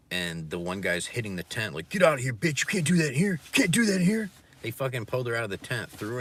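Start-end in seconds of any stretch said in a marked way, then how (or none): 1.9-4.3 high, loud mock yell; retold yell. Retelling a man evicting a woman from a tent, high loud yell. Guy yelling at overdosing woman.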